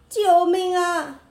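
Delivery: crying for help. A narrator's reading. Donkey suddenly falls in hole.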